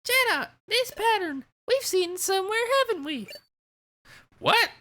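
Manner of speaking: cheerful high-pitched guild leader; bright childlike head voice; high guildmaster voice. Acted. Guildmaster character voice.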